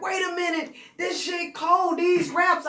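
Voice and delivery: high, slow shouted praise; excited shout. Retold or imitated. Buck hyped over the notebook raps, high slow shout. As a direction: hype it up like you found gold.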